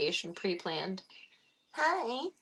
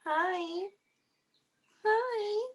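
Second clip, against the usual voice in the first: much higher squeaky hi; greeting the cat; 13 semitones higher than usual. Real, not acted. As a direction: pitch way up for a shy kitty.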